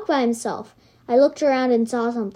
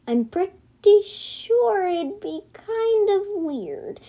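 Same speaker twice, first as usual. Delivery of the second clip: high, slow, unsure voice; slow puzzled musing; 8 semitones higher, much slower than usual. A narrator's reading. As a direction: drag it out, puzzled.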